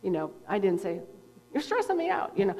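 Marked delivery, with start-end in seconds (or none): 1.5-2.4 high, pouty mock whine; mock complaint. Mocking the note she never sent, high mock whine. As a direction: whine it in a much higher voice. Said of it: "I just said it like that".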